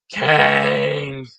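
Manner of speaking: long, held shout of the name; drawn-out announcement. Real, not acted. Host reveals his favorite figure, drawn-out announcing shout. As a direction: call it out on one sustained note.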